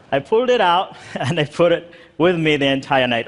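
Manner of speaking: chuckling through the words; laughing. Real, not acted. Speaker tells audience he dug up his passport, laughing. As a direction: laugh while you admit it.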